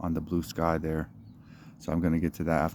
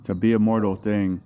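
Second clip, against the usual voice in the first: raised, louder opening line of a poem; poetry reading; 3 semitones higher, 5 dB louder than usual. Acted. Poet opens a reading in the park, declaiming. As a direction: declaim it like a spoken-word poet.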